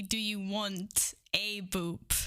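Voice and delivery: drawn-out low question; slow explanatory take; slow, careful. Shown as a demonstration. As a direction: spell it out slowly. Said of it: "It was supposed to be".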